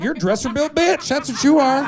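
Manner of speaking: high, sneering witch voice. Retold or imitated. Witch cursing the kitchen lady, high and sneering. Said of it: "She's like".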